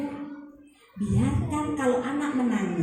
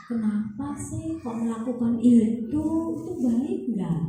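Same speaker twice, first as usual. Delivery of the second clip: put-on kneel at eye level and ask the child kindly; gentle mother's voice, growing softer; 6 dB quieter than usual. Shown as a demonstration. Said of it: "Dengan nada yang lembut".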